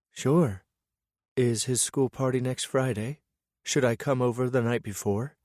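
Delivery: a hint of a cheerful woman; lightly voiced woman. Narrator voices Lillian texting.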